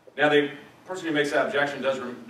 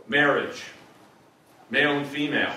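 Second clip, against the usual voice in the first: ordinary delivery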